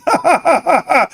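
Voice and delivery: high fake cackle; mocking laugh. Streamer taunting AMC holders with a fake cackle. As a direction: laugh at them, hamming it up. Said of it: "are those real laughs".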